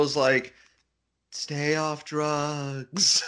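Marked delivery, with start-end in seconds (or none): 1.3-3.2 very drawn-out mock-solemn warning; slow, hammed-up angelic admonition; preachy angel warning. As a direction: stretch each word like a heavenly PSA.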